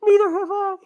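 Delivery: very high, loud squeaky imitation; squeaky mock voice. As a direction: squeak it like a tiny mouse. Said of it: "John's like".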